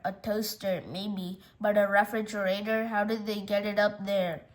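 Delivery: low, soft muttering to oneself; baffled Uncle Murray. Acted. Uncle dazed by the refrigerator in the tree, low muttering. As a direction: puzzle it over quietly, dazed.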